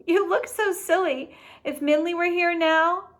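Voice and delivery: laughing. Acted. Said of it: "She laughed".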